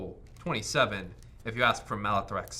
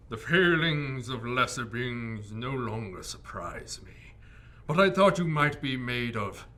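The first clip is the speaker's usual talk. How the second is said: disdainful dragon voice; slower than usual. Acted.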